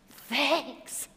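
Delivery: put-on hushed, wide-eyed toddler thanks; imitating a child's amazed gratitude; grateful little girl. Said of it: "It was pure gratitude".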